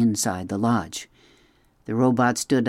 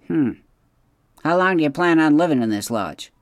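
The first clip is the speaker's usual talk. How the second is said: put-on a higher, curt refusal from an old beaver; gruff beaver; 5 semitones higher, 4 dB louder than usual. A narrator's reading. Mister Beaver turns down the robot's gift, curt. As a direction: brush the offer off fast.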